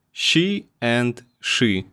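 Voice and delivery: slow "she and she", the second darker; "she and she" spoken slowly to contrast the sounds; two kinds of she. Shown as a demonstration. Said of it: "Take a look at these two syllables".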